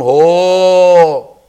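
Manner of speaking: loud cry of cold shock; father imitating a shocked shout; mock scream. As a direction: yelp like falling into icy water. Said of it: "first you scream".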